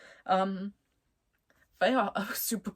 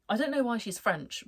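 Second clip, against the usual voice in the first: ordinary delivery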